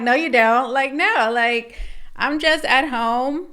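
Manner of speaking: mock blunt retort. Tarot reader voicing the meme's punchline, mock blunt retort. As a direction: loudly act out a comic contradiction. Acts out blunt reply to meme excuse.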